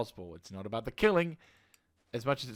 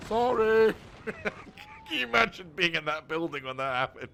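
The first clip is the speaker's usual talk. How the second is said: laughing; 4 semitones higher, 7 dB louder than usual. Real, not acted. Laughing at the destroyed building.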